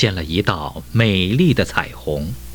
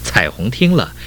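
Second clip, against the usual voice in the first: ordinary delivery, nothing marked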